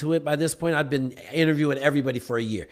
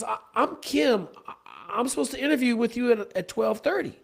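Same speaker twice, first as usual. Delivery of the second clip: nervous candidate; 7 semitones higher, 5 dB quieter than usual. Retold or imitated. Kim nervously introduces herself.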